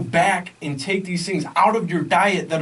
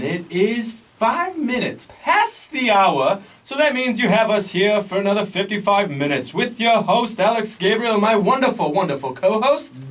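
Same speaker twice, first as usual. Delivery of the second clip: radio host intro; 6 semitones higher, 3 dB louder than usual. Acted. Co-host welcomes listeners back on air as a high, upbeat announcer. Opens radio show segment.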